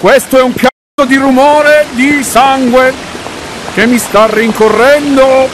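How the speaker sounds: high, loud, frightened yell; panicked yelling. Real, not acted. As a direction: yell while being chased. Chased by noise in game.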